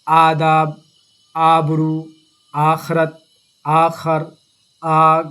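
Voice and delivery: teacher drilling the long aa of each word; stretched alif. Shown as a demonstration. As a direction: drill آداب, آبرو, آگ with a long first aa. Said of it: "تھوڑا کھینچ کر پڑھیں گے".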